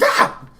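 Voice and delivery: sudden loud startled yelp; a sharp yelp as an arrow nearly hits; yelp of surprise. Acted. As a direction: jump at the near miss. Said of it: "a yelp of surprise".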